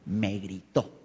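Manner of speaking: low, soft, subdued conclusion; acted inner verdict. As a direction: quietly voice someone's private grievance. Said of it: "la nueva realidad para la persona".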